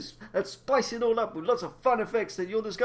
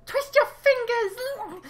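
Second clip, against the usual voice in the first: high menacing villain; much slower than usual. Acted.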